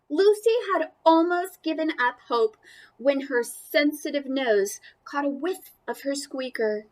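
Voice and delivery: plain reading voice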